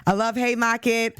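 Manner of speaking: Boston accent, Haymarket said "Haymakit" with no r. Shown as a demonstration. Host showing her Boston accent to viewers, Boston Haymarket. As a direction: drop the r in Haymarket like a Boston local. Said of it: "Haymarket with my Boston accent".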